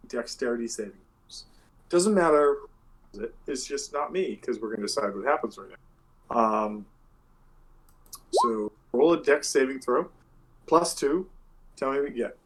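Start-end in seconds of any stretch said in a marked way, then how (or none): none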